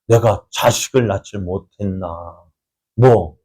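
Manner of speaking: usual speaking voice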